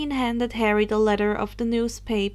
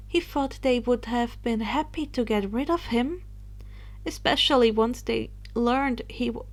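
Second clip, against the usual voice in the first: ordinary delivery, nothing marked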